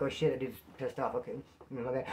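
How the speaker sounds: soft, low backing-down murmur; cowed aside. Acted. Inmates reacting to a pissed-off dude, quietly backing down. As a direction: drop the voice and back off.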